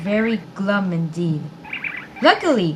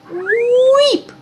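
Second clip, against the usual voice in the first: high, loud alarmed guinea pig squeak; frightened squeak; 9 dB louder than usual. A narrator's reading. Bertie sees the vet's tweezers, scared high squeak. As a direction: squeak at the sight of the shiny tool. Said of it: "squeaked Bertie".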